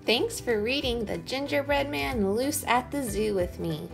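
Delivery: usual speaking voice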